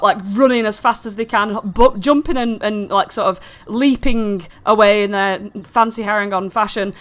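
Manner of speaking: ordinary talk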